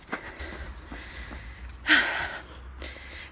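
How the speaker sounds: long breathy high sigh; heavy exhausted sigh. Real, not acted. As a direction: sigh out from the hill climb. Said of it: "this is how I'm feeling".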